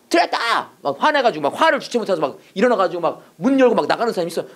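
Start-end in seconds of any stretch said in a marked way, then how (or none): 0.1-0.7 high-pitched mock outburst; imitated angry yell. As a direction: act out a furious heckler crying out. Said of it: "막 소리 지르면서 막".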